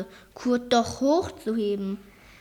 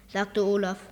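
ordinary delivery, nothing marked

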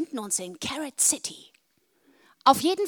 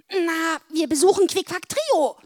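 excited; 7 semitones higher, 7 dB louder than usual. A narrator's reading. Putzi voiced loudly.

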